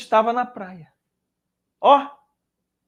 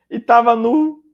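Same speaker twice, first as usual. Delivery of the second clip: laughing; 4 semitones higher, 8 dB louder than usual. Real, not acted. Laughs at Peter being naked.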